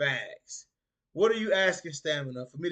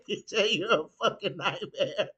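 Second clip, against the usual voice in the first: reading it out through laughter; laughing; 5 semitones higher than usual. Real, not acted. Streamer reading a viewer's teasing comment, laughing. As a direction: struggle to speak through the giggles.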